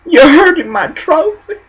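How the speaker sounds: high, mock-offended whine; mocking offended worker. Mocking a worker who takes offence at being corrected. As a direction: ham up a touchy worker's outrage. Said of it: "You tell somebody how to do something right".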